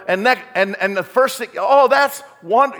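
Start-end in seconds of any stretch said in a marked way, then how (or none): 1.6-2.7 mock dismay.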